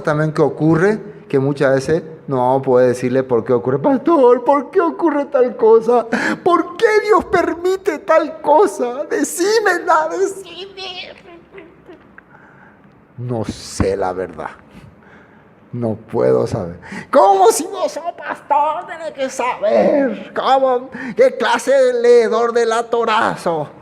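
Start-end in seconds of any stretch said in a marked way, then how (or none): 3.8-11.1 much higher, whiny begging questioner; pleading churchgoer. Retold or imitated. Congregant questions the pastor. 17.1-23.7 much higher, laughing scornful challenge; mocking churchgoer. Congregant mocks the pastor.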